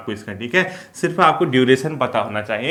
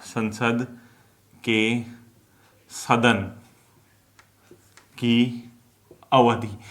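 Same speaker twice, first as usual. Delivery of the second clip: "संसद ... के ... सदन ... की ... अवधि" with long pauses between words; word-by-word dictation; much slower than usual. Shown as a demonstration. Teacher dictating notes to students, slow word-by-word dictation. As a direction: dictate "संसद के सदन की अवधि" one word at a time, pausing after each.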